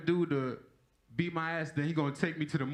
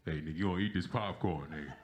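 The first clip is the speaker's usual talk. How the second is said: much lower, gruff dad voice; dad insisting; 12 semitones lower than usual. Retold or imitated. Dad to his sulking son, low gruff insistence. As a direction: push the popcorn on him, low and rough. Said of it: "my dad crazy".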